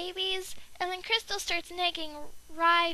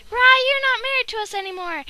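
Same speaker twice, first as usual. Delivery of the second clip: much louder, higher mock yell; angry shout; 5 semitones higher, 11 dB louder than usual. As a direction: kick him out with a shout. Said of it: "Conjo was like".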